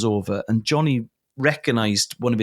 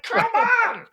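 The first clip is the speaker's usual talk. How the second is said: exasperated inner yell. Retold or imitated. Inner panic at his squeaky voice.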